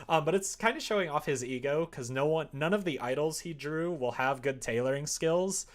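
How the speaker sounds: usual speaking voice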